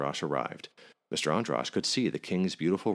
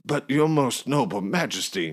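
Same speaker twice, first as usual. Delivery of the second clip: higher, louder pleading; pleading innkeeper; 8 semitones higher, 6 dB louder than usual. A narrator's reading. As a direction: beg the king's pardon. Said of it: "began Mister Androsh".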